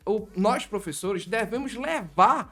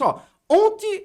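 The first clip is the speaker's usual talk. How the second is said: much higher, louder, slow drawn-out opener; dramatic hook; 14 semitones higher, 9 dB louder, slower than usual. Retold or imitated. Teacher opening a story to grab students, dramatic call. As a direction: draw the class in with a big slow call.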